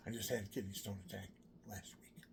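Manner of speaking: ordinary talk, nothing marked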